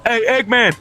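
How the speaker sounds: normal talking voice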